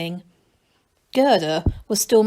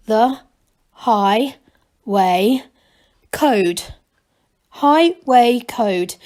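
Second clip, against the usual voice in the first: slow, each word pointed out deliberately; spelled out word by word; 5 dB louder, much slower than usual. A narrator's reading. Young gnome reading the book title aloud, slow and deliberate. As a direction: read it out word by word, slowly. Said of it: "he pointed each one out".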